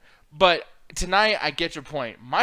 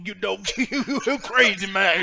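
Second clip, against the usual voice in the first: mock Booker T. Mocking Booker T commentary.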